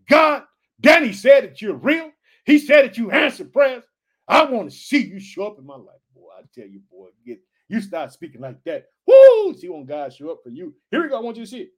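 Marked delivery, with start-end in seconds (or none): 0.1-6.0 loud, high call out to God; shouted prayer. Pretend. 9.1-9.5 excited whoop. Real, not acted.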